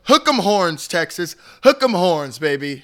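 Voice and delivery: loud, high rally cheer. Real, not acted. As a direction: yell it like a fan in the stands. Texas cheer, hyped.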